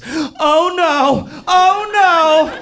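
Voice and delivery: very high, whimpering oh-no's; helpless dismay. Retold or imitated. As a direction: repeat it in a comic squeal of distress. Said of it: "all I could mutter from my mouth was".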